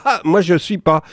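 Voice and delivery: mock Dupont-Aignan laughing reply. Politician answers the fan, lightly amused mock voice. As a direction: toss it off lightly, half laughing. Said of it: "répond en rigolant".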